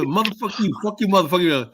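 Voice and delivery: hammed-up angry cursing; playful mock shouting match; mock street cursing. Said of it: "they'll curse at each other".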